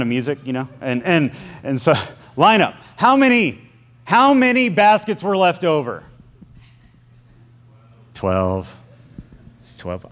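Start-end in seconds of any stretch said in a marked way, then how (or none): 2.3-6.0 high, loud scolding parent voice; line them up like a cross dad; stern parent. Acted. Jesus as a cross parent to the disciples, high and loud. 8.1-10.1 low, soft guilty mumble; sheepish mumble. Acted. Disciples answering the scolding Jesus, low sheepish mumble.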